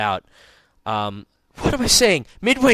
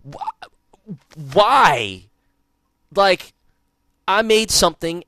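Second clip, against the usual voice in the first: worked up; 7 semitones higher, 5 dB louder, slower than usual. Real, not acted. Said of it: "I agree completely".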